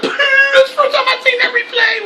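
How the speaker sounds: very high, loud mock blubbering wail; hammed-up high sobbing shout; Ric Flair crying. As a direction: wail it like an old wrestler overcome with tears. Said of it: "And Ric Flair went".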